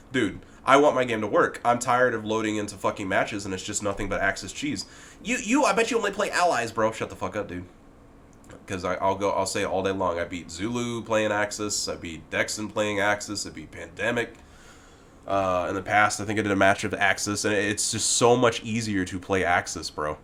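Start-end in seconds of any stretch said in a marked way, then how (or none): none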